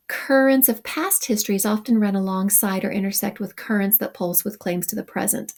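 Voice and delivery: usual speaking voice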